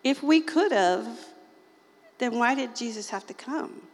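ordinary delivery

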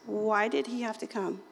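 usual speaking voice

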